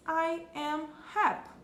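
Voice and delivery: slow, clear "I am happy" in English; careful English model. Shown as a demonstration. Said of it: "em inglês a gente diz".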